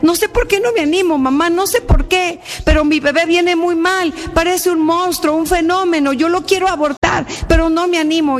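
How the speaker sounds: acted distress on the phone; retold anguish. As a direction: confess helplessly to mother, voice held high.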